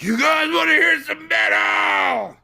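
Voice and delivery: high, slow shouted rock-singer call; metal band frontman. Retold or imitated. Metal singer shouting to the crowd from the stage. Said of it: "and they were like".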